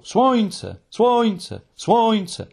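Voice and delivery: high, evenly repeated echo calls; ringing repeated echo. A narrator's reading. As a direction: bounce the same high cry back over and over. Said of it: "powtarzało echo".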